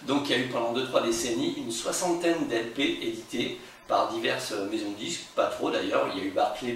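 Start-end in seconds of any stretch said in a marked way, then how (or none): none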